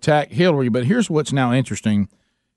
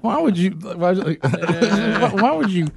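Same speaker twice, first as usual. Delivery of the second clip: higher, repeated exasperated why; imitating the wife; 8 semitones higher than usual. Husband retelling wife's reaction to his teasing, higher and exasperated. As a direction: plead it like a baffled wife. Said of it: "she just looked at me like".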